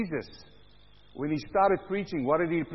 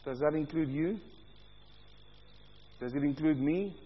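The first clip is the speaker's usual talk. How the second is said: ordinary delivery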